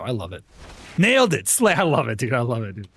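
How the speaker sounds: ordinary delivery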